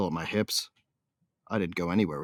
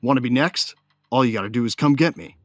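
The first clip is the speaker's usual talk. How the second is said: loud, higher defiant jeer; loud taunt; 5 semitones higher, 8 dB louder than usual. Acted. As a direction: dare him to come.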